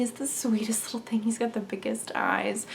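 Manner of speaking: soft whisper of adoration; tender whispered gush; adoring whisper. Real, not acted. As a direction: hush as if the kitten sleeps nearby. Describing the kitten fondly.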